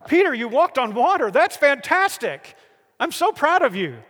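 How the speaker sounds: high, loud, delighted cheering; excited, proud praise. Acted. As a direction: celebrate his feat like a thrilled coach. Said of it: "hard to capture the emotion".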